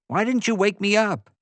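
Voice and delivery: high-pitched, put-out question; indignant complaint. A narrator's reading. Ray turning to Pete after missing the bear, indignant. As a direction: demand it, pitched right up.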